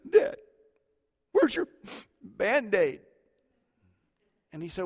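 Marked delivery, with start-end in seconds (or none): 0.1-3.0 mock gasps between words, high little-kid voice; high-pitched, broken by pretend sob-gasps; imitated post-sob hiccuping. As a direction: imitate a small child catching breath after sobbing. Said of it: "when you've been sobbing, you do".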